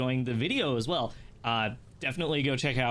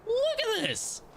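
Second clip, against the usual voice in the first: much higher, excited; excited squeal; slower than usual. Real, not acted. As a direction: squeal it in delight. Showing a view in game.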